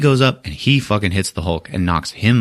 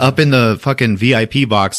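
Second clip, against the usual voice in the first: usual speaking voice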